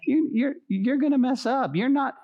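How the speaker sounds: high mocking voice of the enemy; a hint of a jeering devil; taunting enemy. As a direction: sneer it like a tempter. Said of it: "he whispers in your ears".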